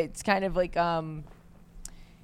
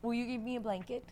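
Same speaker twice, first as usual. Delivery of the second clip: soft, higher, sweet little request; cute ask; 4 semitones higher, 14 dB quieter than usual. Retold or imitated. Roommate asking for a blanket, cute and soft. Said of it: "She asked so cute".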